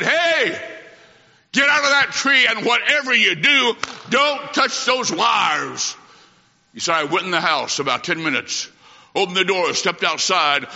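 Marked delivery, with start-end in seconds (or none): 0.0-6.0 retold warning yell. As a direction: yell the warning, stretching the last words slow. Boy yells warning at brother.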